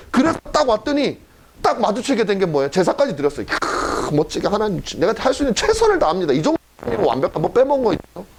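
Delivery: ordinary delivery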